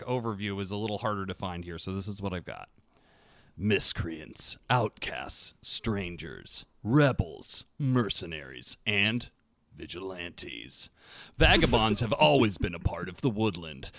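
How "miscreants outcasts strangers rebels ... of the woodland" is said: measured, dramatic read-aloud with deliberate pauses; best reading voice. Real, not acted. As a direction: read the lore aloud, lowering the line endings. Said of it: "in your best reading voice".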